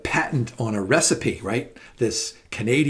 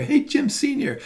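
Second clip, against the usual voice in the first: lightly voiced restaurant owner, a high bright hey; eager caller. Canadian restaurant owner calling my dad, a lightly voiced eager hello. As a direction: greet him like an eager stranger on the phone.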